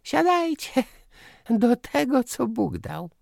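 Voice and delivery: very high, loud aunt voice; high-pitched summons, easing at the end; calling out. A narrator's reading. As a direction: call everyone to the table. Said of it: "zawołała".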